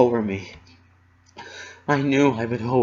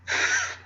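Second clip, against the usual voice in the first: choked sob; 5 dB quieter than usual. Acted. Sobbing breakdown in narration.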